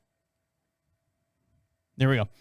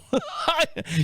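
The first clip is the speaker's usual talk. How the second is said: high laugh; 8 semitones higher than usual. Real, not acted. Laugh inside cop quote.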